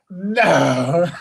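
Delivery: high, loud disbelieving no; can't believe the tree came down; stunned no. Real, not acted. Truck driver reacting to a tree falling on the truck, stunned disbelief.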